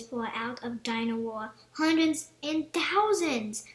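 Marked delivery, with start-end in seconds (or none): 1.7-3.7 louder, slow delighted cry; excited cry. A narrator's reading. Peppa seeing batteries pour out, excited cry. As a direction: marvel at the pile. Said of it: "cries Peppa".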